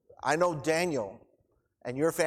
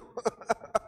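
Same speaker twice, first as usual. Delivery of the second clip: short real laugh; a quick genuine chuckle; 8 semitones higher than usual. Real, not acted. Laughs at his own tears.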